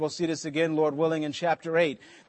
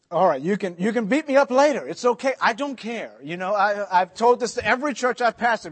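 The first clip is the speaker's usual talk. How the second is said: usual speaking voice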